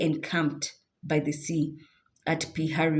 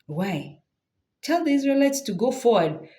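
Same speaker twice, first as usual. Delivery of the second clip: God answering; 6 semitones higher than usual. Acted. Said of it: "So God is like".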